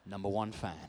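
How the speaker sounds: low, deadpan mock quote; sarcastic echo. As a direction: drily throw the fan's claim back. Sarcastic echo of the fan's claim.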